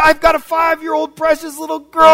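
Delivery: ordinary delivery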